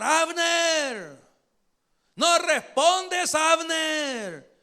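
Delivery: high, drawn-out call across a distance; calling out shout. Acted. David calling to Abner from the hilltop, shouting. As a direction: holler to wake a sleeper far off. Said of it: "comenzó a gritar David".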